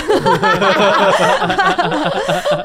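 high loud real laughter. Real, not acted. Laughing as she tells friends about the husband, loud laughter. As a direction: burst out laughing.

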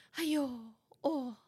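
high, breathy half-whisper of pity; mimicking mom's pity. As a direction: imitate mom cooing over a sad video. Mom reacting to sad videos.

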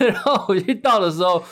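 ordinary delivery

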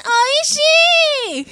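high, sing-song cute praise; high cute oishii. Pretend. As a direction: squeal it like an anime girl. Mock Japanese "delicious.

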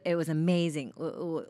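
normal talking voice

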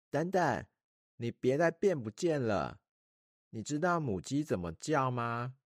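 put-on high, childlike girl voice; a small princess making a wish; little princess voice. A narrator's reading. Princess calls genie back.

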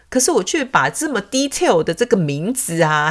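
usual speaking voice

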